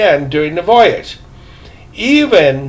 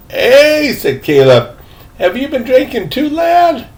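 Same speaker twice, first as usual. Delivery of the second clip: put-on old man startled; 8 semitones higher than usual. A narrator's reading. Old man startled, voiced character.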